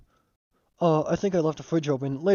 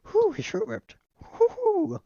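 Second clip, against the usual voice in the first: laughing; much slower than usual. Real, not acted. Player laughing at the game.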